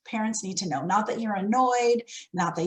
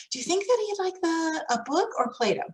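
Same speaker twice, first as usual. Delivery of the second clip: asking choice; 7 semitones higher than usual. Shown as a demonstration.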